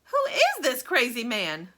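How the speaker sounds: screamed. A narrator's reading. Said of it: "screamed Grandma Josephine".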